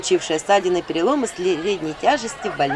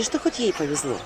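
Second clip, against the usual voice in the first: ordinary delivery, nothing marked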